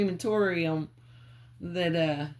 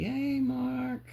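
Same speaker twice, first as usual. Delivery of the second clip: calling out; 7 semitones higher than usual. Real, not acted. Calling out to a spirit.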